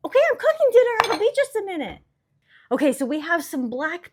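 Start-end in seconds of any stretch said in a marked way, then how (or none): 0.1-2.0 loud, high call to another room. Real, not acted. Calling to child in other room.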